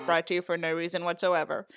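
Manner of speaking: ordinary delivery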